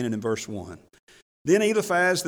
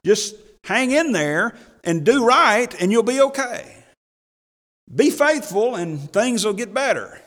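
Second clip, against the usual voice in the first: cheery advice; 6 semitones higher, 4 dB louder than usual. Retold or imitated. Job cheering on suffering friends, mock pep talk. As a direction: cheer them on a bit too easily. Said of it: "what Job actually said to others".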